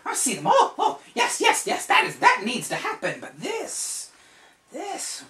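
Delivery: very high, loud, squeaky wizard voice; excited old wizard. Acted. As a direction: gush like a frantic little sorcerer. [name] examining the marks.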